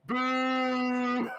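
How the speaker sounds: crowd booing. Retold or imitated. Game master imitating the crowd booing the joke. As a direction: boo like a whole stadium. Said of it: "the whole crowd".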